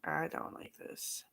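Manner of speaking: slow unvoiced whisper; telling how the stress felt, in a slow whisper. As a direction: breathe it out like you're overwhelmed. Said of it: "I was like".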